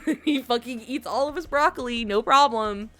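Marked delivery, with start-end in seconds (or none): 0.1-0.2 high, loud delighted exclamation; thrilled gasp. Retold or imitated. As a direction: squeal with joy at the cake. Sora thrilled by cake offer.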